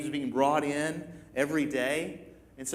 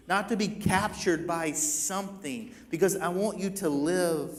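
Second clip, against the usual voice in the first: a higher, pleading preacher's call; impassioned preaching; 4 semitones higher than usual. Acted. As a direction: press the plea home to the listeners. Jesus' plea preached with force.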